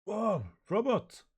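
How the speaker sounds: high, loud, drawn-out astonishment; astonished cry. Acted. Manga character suddenly sees the robot, astonished.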